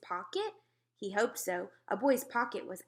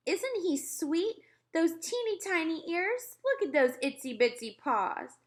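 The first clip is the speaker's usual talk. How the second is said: put-on cooing girls; 8 semitones higher than usual. A narrator's reading. Girls gushing over the tiny mouse. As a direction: coo over something cute. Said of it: "cried the girls".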